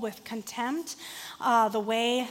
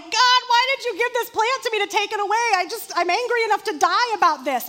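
high, loud whining complaint; whiny tantrum; 8 semitones higher, 6 dB louder than usual. Retold or imitated. Jonah whining loudly at God about the plant. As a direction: throw a childish fit at God, pitched up. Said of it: "And he's like".

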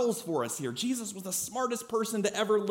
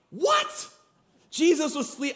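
shocked, very high "What?"; amazed high squeal; 9 semitones higher, slower than usual. Real, not acted. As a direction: act stunned disbelief. Amazed at sleeping Jesus.

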